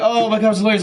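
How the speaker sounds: high, excited mock gushing; imitated yelling. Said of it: "He yelled at me".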